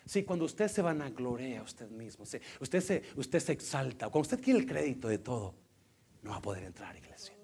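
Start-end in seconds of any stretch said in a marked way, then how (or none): none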